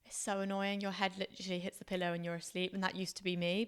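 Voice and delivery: ordinary delivery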